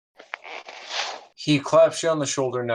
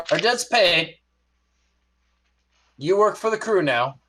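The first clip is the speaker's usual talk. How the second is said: much higher first mate, firm; first mate parting words; 9 semitones higher, 3 dB louder than usual. Acted. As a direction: declare the debt settled as you leave. First mate walks off declaring.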